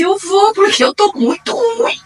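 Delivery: imitating a high, drawn-out sick whine. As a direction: play herself worn out, pleading, pitched high. Retelling her own sick reply. (imitando uma voz aguda e arrastada de doente)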